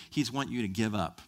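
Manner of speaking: usual speaking voice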